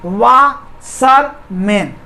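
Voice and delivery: Washerman very slowly, pausing between वा, शर, मैन; three long separated beats on washerman; split into syllables. Shown as a demonstration. Teacher showing it divides in three, split into syllables. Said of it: "तीन syllable हुआ ना".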